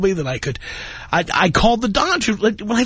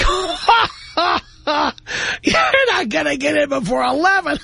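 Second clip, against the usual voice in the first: high, slow scornful brush-off; mock the reservation desk turning you away; snooty restaurant host; 7 semitones higher, slower than usual. Host scoffing at a caller wanting a table, slow and high. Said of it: "it would be like".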